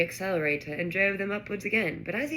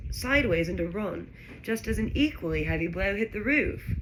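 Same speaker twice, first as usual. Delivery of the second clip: usual speaking voice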